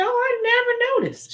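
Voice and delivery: high startled reply; surprised. Retold or imitated. Retelling talk with friend Cece, high surprised reply. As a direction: act amazed at the news. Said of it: "I said".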